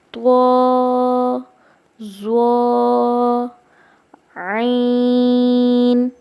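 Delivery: slow, loud, drawn-out letter names; teacher modeling letter names; letter drill. Shown as a demonstration. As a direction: call each letter out slowly for students to copy. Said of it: "آج ہم پڑھیں گے سین".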